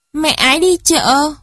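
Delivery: put-on high-pitched childish answer; reply as a small child, pitched well up; little child's voice. (giọng trẻ con cao, hồn nhiên)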